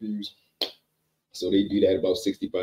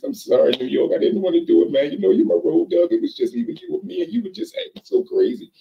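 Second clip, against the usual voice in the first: very high, louder whimpering plea; desperate pleading; 13 semitones higher, 5 dB louder than usual. Retold or imitated. Hog-tied Billy begs New York very high and loud. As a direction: beg up high, close to tears.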